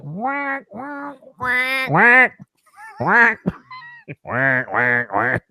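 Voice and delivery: imitating a duck on the speaker; playing the Echo duck sound; high mock quacking.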